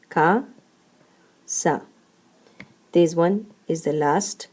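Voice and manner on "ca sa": "Ca, sa" as two separate syllables, pause between. Shown as a demonstration. Teacher counting the two syllables of casa, syllable by syllable. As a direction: leave a clear gap after "Ca" before "sa". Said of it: "two syllables".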